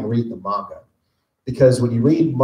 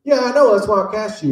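director; 9 semitones higher than usual. Retold or imitated. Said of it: "He goes".

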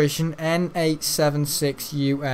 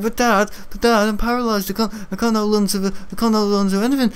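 higher, quick pleading kid voice, half-imitated; son's panicked voice; 6 semitones higher, 3 dB louder, faster than usual. Son on the roller coaster crying to dad, higher and rushed. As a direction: play the frightened son clinging on, rushing.

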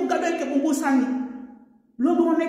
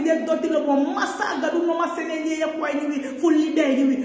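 ordinary delivery

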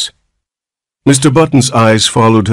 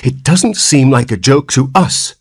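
severe; 7 semitones higher than usual. Acted. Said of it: "replied the nurse severely".

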